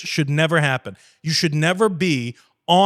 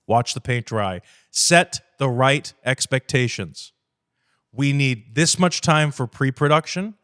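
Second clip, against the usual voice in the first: ordinary talk, nothing marked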